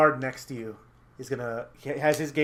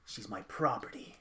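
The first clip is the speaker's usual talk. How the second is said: slow, low, quiet menace; hushed villain staking his claim; soft, drawn-out contempt; possessive villain; 4 semitones lower, 9 dB quieter, slower than usual. Acted. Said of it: "Pietro looks at him and goes".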